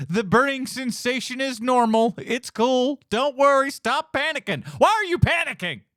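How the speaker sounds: high-pitched, hammed-up calming of someone panicking; badly faked reassurance, laughing early, loud by the end; mock frantic reassurance. Mocks the technician calming an actor.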